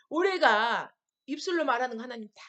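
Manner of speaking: normal talking voice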